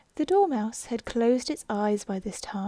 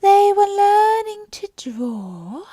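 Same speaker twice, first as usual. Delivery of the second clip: much higher, slow, sleepy mouse voice; yawning Dormouse; 10 semitones higher, 10 dB louder, slower than usual. Acted. Sleepy Dormouse resuming its story, slow and much higher. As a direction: drag it out half yawning. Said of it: "yawning and rubbing its eyes".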